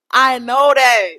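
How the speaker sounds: slow, loud and fed up; emphatic loud. Real, not acted. Fed up with guys repeating she looks good, slow loud insistence. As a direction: lean hard on every word, exasperated.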